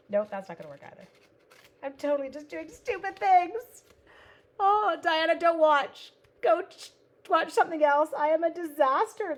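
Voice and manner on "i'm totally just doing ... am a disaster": loud, high, laughing through the words; laughing at herself. Real, not acted. Crafter laughing to a viewer about her mess. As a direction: giggle at your own disaster.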